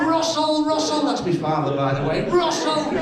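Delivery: high calling out a name; hammed-up shout for her husband; mum calling out. Mum yelling for her husband, high calling.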